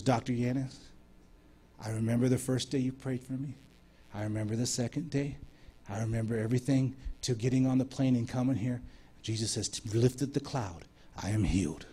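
soft, low retelling of a solemn testimony; hushed testimony. Retold or imitated. Woman telling the preacher she remembers and is healed, hushed.

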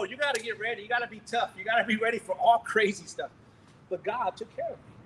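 ordinary talk, nothing marked

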